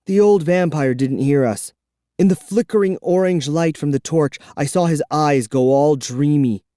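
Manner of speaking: ordinary delivery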